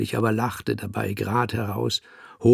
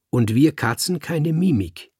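kecke Antwort; 6 semitones higher, 5 dB louder than usual. Acted. Kater kontert keck.

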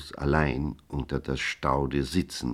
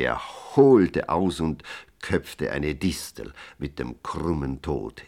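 ordinary delivery

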